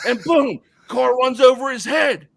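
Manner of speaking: loud, high, drawn-out punch; climactic exclamation. Acted. Storyteller hits the mouse's fatal accident, loud dramatic climax. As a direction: blurt out the crash, then stretch the disaster.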